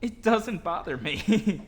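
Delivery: slow, laughing through the words. Real, not acted. Narrator laughs off the dog's kisses, slow and giggly. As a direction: giggle it out while being licked. Laughing while dog licks her.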